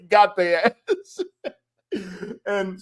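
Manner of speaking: high, slow words through laughter; real laughter. Real, not acted. Comedian relishing the prank he retold, laughing hard. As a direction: laugh your way through it, drawn out.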